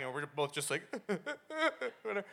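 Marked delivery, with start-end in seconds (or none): 0.9-2.0 high, stifled mock sobs; mock crying. Teller imitates father and son trying not to cry, mock sobbing. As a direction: imitate choked-back crying in little bursts. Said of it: "and I start crying".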